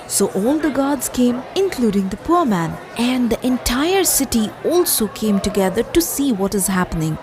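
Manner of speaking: plain reading voice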